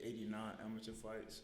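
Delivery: usual speaking voice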